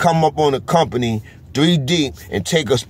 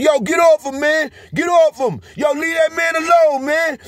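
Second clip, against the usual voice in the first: very high, urgent retold shouts; imitated prisoners' yelling. Prisoners yelling at officers beating a man, imitated high shouts. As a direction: yell like inmates protesting from their cells. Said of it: "dudes are screaming, yelling".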